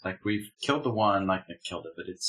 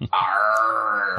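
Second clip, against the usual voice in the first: a hint of a pirate growl; louder, lightly voiced pirate; 5 dB louder than usual. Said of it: "It is Talk Like a Pirate Day".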